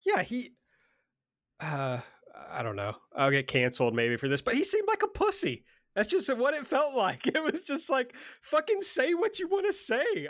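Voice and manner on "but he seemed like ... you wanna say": high-pitched exasperated rant; real exasperation, pitch riding high; fired up. Real, not acted. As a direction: vent in a worked-up, much higher voice. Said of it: "I'm just fired up about this".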